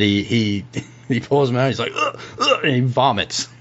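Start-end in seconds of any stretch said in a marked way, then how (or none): 2.0-2.6 high retching noises; two short gags before vomiting; acted-out high gagging. Game master acts out John vomiting.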